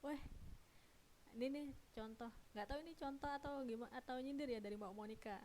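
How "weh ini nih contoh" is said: usual speaking voice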